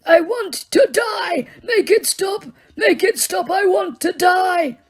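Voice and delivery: high, slow, shouted wish to die; old man screaming in anguish. Acted. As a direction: scream in unbearable pain. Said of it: "began to scream in more anguish".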